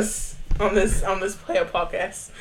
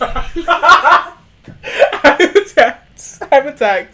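mock offended; 6 semitones higher, 12 dB louder, much slower than usual.

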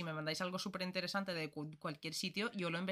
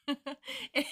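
nervous laugh; 8 semitones higher than usual. Real, not acted. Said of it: "Me río de los nervios".

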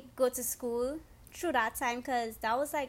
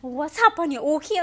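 alarmed sister; 4 semitones higher, 11 dB louder than usual. Retold or imitated. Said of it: "she's like".

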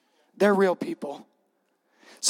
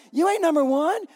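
higher, mock-indignant retort; acted squabbling put-down; 9 semitones higher than usual. Ten disciples snap back at the two brothers, indignant. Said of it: "they were indignant at the two brothers".

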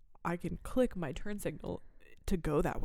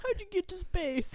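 playing along in character; 11 semitones higher than usual. Joins the character bit.

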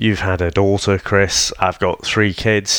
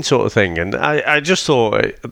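ordinary delivery

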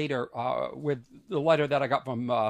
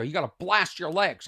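ordinary talk, nothing marked

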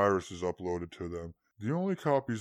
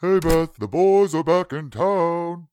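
loud slow sing-song call; 5 semitones higher, 7 dB louder, slower than usual. Acted. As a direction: call out across the house. Calling into house.

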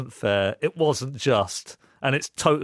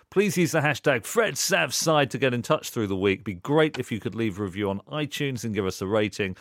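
radio sign-off read; 5 dB louder than usual. Acted.

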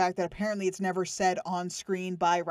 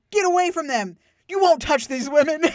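higher, louder hammed-up hero line; mock heroic shout; 7 semitones higher, 6 dB louder than usual. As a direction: overdo the tough-guy line. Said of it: "his dialogue during that fight".

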